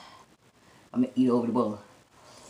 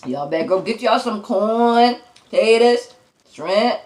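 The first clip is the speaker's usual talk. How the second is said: raised voice, listing the food to viewers; hyped-up listing; 5 semitones higher, 4 dB louder than usual. Real, not acted. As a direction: sell it to the viewers, voice up. Hyping the seafood to viewers.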